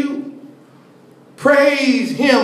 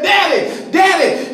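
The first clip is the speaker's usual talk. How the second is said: put-on high child's call to his father; child calling daddy; 8 semitones higher than usual. Small boy calling up to his father.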